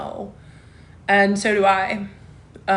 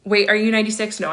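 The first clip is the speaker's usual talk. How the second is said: ordinary talk, nothing marked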